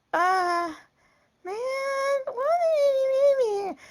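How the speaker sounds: high, drawn-out imitation whine; hammed-up high wail; mock whining. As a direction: whine it like a tearful daughter. Imitating daughter's tearful protest.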